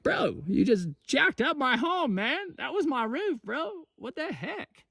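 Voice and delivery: high squeaky animal complaining, slowed; high pitched indignant critter voice. Retold or imitated. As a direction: play the upset little forest critter whose home got wrecked. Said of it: "They're like".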